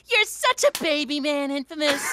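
high pet-talk voice; high doggy baby talk; puppy talk. Acted. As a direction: say it like cooing at puppies. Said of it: "like I'm talking to my puppies".

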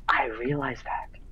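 slow whispered admission; whispering. Real, not acted. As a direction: whisper it, slowly and sheepishly. Mutters after being teased.

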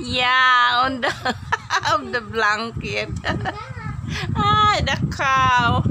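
amused, breaking into laughs between words; laughing. Real, not acted. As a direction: talk through bursts of laughter. Laughing at the whispered cow.

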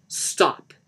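loud, forceful command; sharp order. Acted. Lisa orders Johnny to stop the tape, loudly. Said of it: "Lisa ordered".

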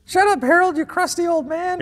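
mock yell. Mock scolding the old seal.

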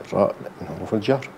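normal talking voice

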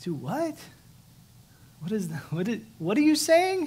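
put-on confused young husband hearing the news; stunned disbelief. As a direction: let it climb into a shocked question. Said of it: "I'm like".

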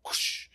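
quick unvoiced rushing whoosh; imitated whoosh. Shown as a demonstration. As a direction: make the sound of something taking off. Imitates sudden spread of sharing.

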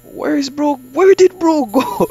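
high, loud, slow bewildered call; baffled shout. Real, not acted. Gamer shouting in confusion after the customer disappears. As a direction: call out, stunned he vanished.

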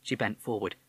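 ordinary delivery, nothing marked

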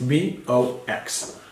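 slow letter-by-letter spelling; slow spelling for a child; spelled out slowly. Shown as a demonstration. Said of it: "spelling for the child".

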